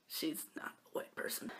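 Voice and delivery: quick whispered joke; whispered aside. Real, not acted. Jokes about her dancing to viewers, whispered aside. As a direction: slip the joke in under your breath.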